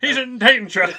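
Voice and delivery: mock alarm. Hammed alarm about Tom.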